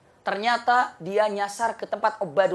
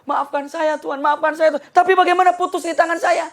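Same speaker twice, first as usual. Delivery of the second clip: higher, louder anxious pleading; pleading servant; 8 semitones higher, 6 dB louder than usual. Acted. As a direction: beg the master's pardon in a rush. Servant apologizes to king.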